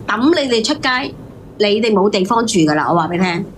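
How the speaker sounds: ordinary talk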